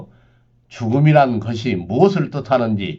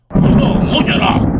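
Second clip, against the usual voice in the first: slow loud booming order; thundering command; 11 semitones higher, 5 dB louder, slower than usual. Acted. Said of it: "우레처럼 소리쳤다".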